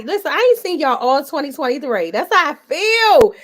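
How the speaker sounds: loud hyped shout. Real, not acted. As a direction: belt it to the crowd. Hyping up the live crowd.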